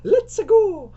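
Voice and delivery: exaggerated cartoon squeal, very high and loud; Mario. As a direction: push the game-hero cry even further over the top. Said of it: "She's turned into Mario".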